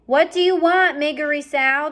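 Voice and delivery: loud, high, calling across; shouting. Acted. Princess shouting at Mig, loud and high. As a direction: yell the question across the dungeon. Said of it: "the princess shouted".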